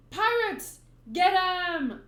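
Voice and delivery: loud, high rallying cry; mob shout. Acted. Townspeople turning on the pirates, shouting. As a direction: shout to set the mob on them.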